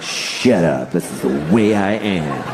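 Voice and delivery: low, slow, self-satisfied mock voice; Matt Damon. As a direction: drawl it out, hugely pleased with yourself. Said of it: "he basically sat down".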